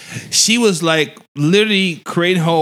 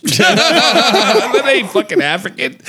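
usual speaking voice

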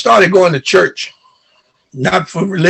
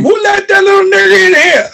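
retold scream; 12 semitones higher than usual. Beach stranger screaming at the boy who kicked sand, furious yell. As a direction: snarl it out, much higher, like the stranger on the beach. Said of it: "and he screamed".

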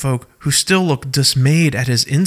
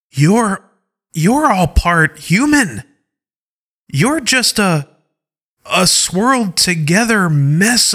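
higher, halting delivery with drawn-out words; disbelief and disgust; 5 semitones higher, slower than usual. Acted. Parker insulting the tribe's origins, in appalled disgust. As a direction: recoil in disbelief, groping for the words. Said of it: "in disbelief and maybe even a little disgust".

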